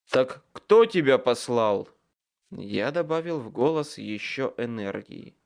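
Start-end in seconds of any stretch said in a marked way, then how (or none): 0.1-1.9 pressing demand. A narrator's reading. Wizard presses the creature.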